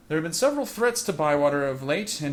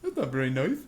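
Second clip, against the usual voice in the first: dopey orc voice; 3 dB louder than usual. Pretend. The party's orc objecting to killing orcs, dopey voice. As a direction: play the thick, good-natured orc. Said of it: "Sluggur's probably like".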